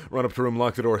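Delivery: plain reading voice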